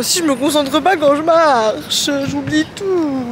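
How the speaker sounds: playful complaint. Real, not acted. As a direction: lament it with mock despair. Comic wail about forgetting everything.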